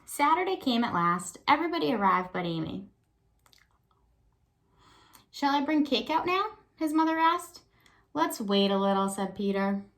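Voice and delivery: put-on lively read-aloud for young kids; story narration. A narrator's reading. Teacher reads picture book aloud.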